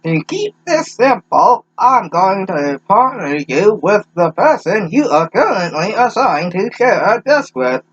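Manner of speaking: put-on high, slow elderly teacher; quavery old teacher voice; old person voice. Same old teacher voice continues.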